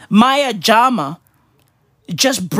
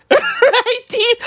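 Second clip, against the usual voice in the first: laughing; 13 semitones higher than usual. Real, not acted. Still laughing at own joke.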